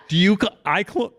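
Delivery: normal talking voice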